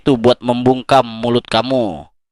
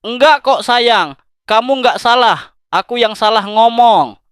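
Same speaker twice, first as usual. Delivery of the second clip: mock soothing; 8 semitones higher, 4 dB louder than usual. Comforting a sulking partner after a fight, high coaxing coo. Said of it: "dan bilang".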